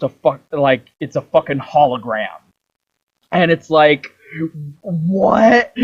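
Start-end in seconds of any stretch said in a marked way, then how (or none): 4.8-5.7 surprised. Retold or imitated. Ezio sees the hologram.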